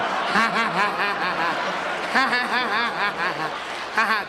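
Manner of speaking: long belly laugh with rising pitch; hearty laugh. Retold or imitated. President laughing at his own joke, booming.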